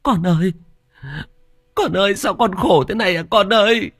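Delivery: keen over your dead son; mother wailing over her dead son's body; grieving wail. Acted. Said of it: "mếu máo".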